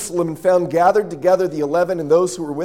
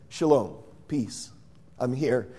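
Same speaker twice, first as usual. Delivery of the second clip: soft, slow and lower, a calm hello; gentle greeting; 6 semitones lower, 8 dB quieter than usual. Acted. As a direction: greet them quietly so they are not scared. Jesus appearing gently to disciples.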